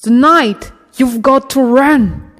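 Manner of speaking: high, loud shout; shout over the rain. Acted. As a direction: yell to be heard over a downpour. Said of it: "had to shout over the rain".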